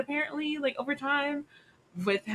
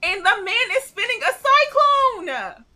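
loud, high disbelieving exclamation; incredulous outcry; 13 semitones higher, 8 dB louder than usual. Real, not acted. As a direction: belt it out in amazed disbelief. Incredulous retelling of fight scene.

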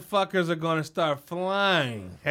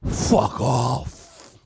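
low, loud old-boss imitation; hammed-up deep brush-off; mock dismissal; 7 semitones lower, 6 dB louder than usual. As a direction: dismiss them like a surly patriarch. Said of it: "He'd be like".

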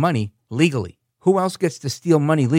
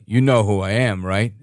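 lower, imitated British gangster; British gangster accent; 4 semitones lower, 3 dB louder than usual. Imitating the candidate pulling clout, low British gangster accent. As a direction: pull rank like a British gangster, pitched down. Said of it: "gangster in a British accent".

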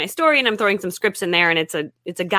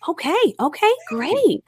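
higher, humoring okay okay great; mock hotel clerk; 9 semitones higher, slower than usual. Wife imitates hotel clerk humoring her book-writing plan, higher voice. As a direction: play the clerk humoring an odd guest, pitched up.

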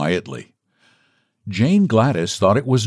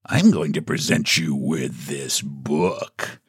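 slow, lower, lightly voiced visitor; a hint of mock menace, low and slow; menacing visitor; 7 semitones lower, 4 dB quieter, slower than usual. A narrator's reading. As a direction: announce it deliberately, drawing it out. Visitor's slow reveal in story.

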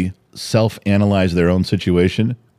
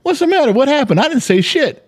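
much higher, bewildered mock protest; mock confused dad. Dad pretends he is baffled when his wife yells goldfish, high mock bewilderment. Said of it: "I'm gonna go".

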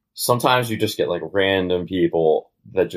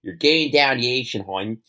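Baltimore accent; 3 semitones higher than usual. Shown as a demonstration. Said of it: "I can check in and check out of this accent".

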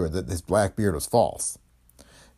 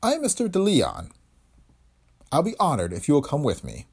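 a touch of courtly gentleman; bow with your voice, polite; 5 semitones higher than usual. Acted. Bearded stranger courteously introducing himself to the dame. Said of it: "His manner was courtly".

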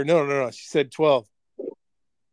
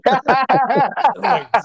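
words broken by laughter; laughing; 7 semitones higher, 5 dB louder than usual. Real, not acted. Laughing while retelling.

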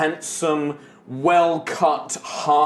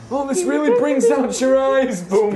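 gushing artist; 10 semitones higher, 4 dB louder than usual. Acted. Artist admiring his work.

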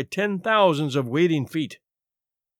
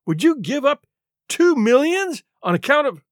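high, louder protest; incredulous cry; 9 semitones higher, 5 dB louder than usual. A narrator's reading. Tall man protests Murray refusing two millions, crying out incredulous. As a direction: push the pitch up in disbelief. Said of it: "cried the other".

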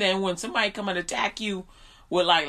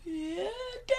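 meek son; 10 semitones higher, 8 dB quieter than usual. Retold or imitated. Son answers meekly.

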